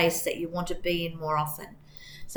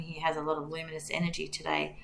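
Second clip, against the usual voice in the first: soft secretive whisper; 8 dB quieter than usual. Real, not acted. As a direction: breathe it like a secret. Shares her angel behind her.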